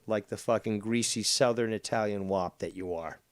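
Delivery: ordinary delivery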